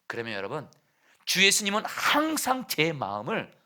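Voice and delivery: usual speaking voice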